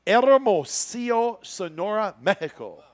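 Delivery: drawn-out, high Mexican-accented place name; hammed-up Spanish accent, starting loud and high; showy Mexican pronunciation. Shown as a demonstration. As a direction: roll out the Mexican name with relish. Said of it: "You ready for this one?".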